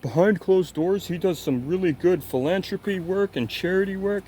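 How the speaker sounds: high, put-on dopey voice; mocking Critical. Mocking Critical's defence of Mister Beast, in a high dopey voice. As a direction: ham up a clueless streamer defending him. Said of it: "funny to see Critical be like".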